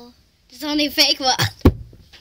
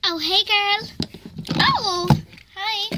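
toy voice; 7 semitones higher, 6 dB louder than usual. Pretend. Play voice greeting a toy.

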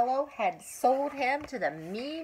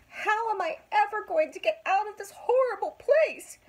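sobbing; 11 semitones higher, 4 dB louder than usual. A narrator's reading. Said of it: "sobbed Pinocchio".

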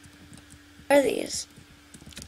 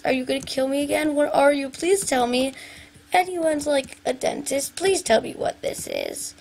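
normal talking voice

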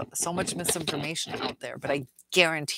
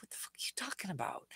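mock narcissist whisper; 15 dB quieter, much faster than usual. Narcissist's reply voiced mockingly.

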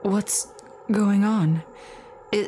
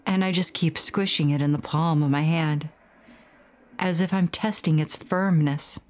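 ordinary delivery

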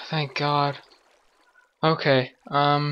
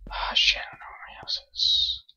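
whispering; 5 dB quieter, faster than usual. Real, not acted. Lost at night, whispering.

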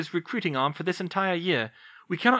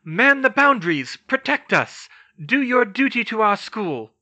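loud, high rallying orders; rally the troops; commanding shout; 9 semitones higher, 8 dB louder than usual. Acted. McGonagall ordering the statues to the boundaries, loud shout.

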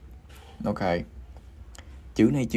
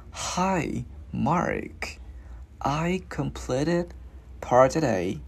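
slow, phrase by phrase for learners; slow demonstration; 4 semitones higher, much slower than usual. Shown as a demonstration. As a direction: ease through it with clear pauses. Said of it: "mình cứ đi từ từ". (đọc chậm rãi từng cụm)

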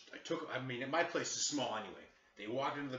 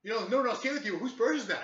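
high, loud badgering imitation; hammed-up suspicious cop; mock interrogating cop; 11 semitones higher, 9 dB louder than usual. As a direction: mimic an officer pressing the accusation. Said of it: "kept basically interrogating me".

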